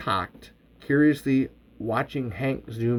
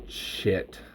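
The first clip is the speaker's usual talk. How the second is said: whispered curse; 6 semitones lower, 8 dB quieter than usual. Acted. Gun clicks empty.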